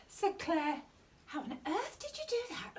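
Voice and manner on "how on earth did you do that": fast worried whisper; whispered question from mum. Acted. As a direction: hush it urgently.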